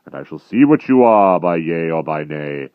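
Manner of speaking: loud, heavy threat; menacing giant. A narrator's reading. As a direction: promise the hunt with relish.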